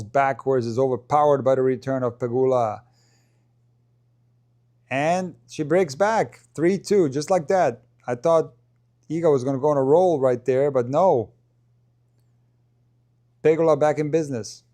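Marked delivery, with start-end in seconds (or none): 4.9-14.6 excited call. Real, not acted. Break back in commentary.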